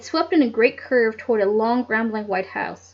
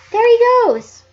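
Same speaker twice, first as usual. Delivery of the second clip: high, loud cry of discovery; excited shout; 12 semitones higher, 10 dB louder than usual. Acted. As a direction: shout as you spot it. Spotting the bird.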